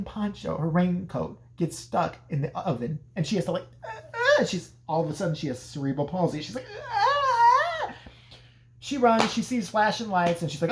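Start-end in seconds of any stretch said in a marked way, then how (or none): 3.8-4.4 very high falsetto yelps; flailing mock shrieks. Alice with her raincoat stuck, high mock shrieks. 6.9-7.9 long, very high falsetto wail; hammed-up drawn-out shriek; helpless mock scream. Alice freeing herself in panic, long mock scream.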